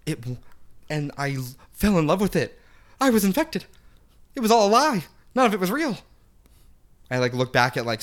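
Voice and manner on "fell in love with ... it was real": high, loud melodramatic lament; mock dramatic. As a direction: wail it like a betrayed lover. Mock tragic confession about cat.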